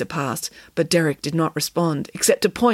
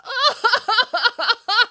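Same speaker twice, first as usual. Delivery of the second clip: high, hammed-up wailing sobs; crying harder; 4 dB louder than usual. A narrator's reading. The children crying over stew, loud theatrical sobs. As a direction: bawl in noisy boo-hoos. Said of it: "The children cried harder".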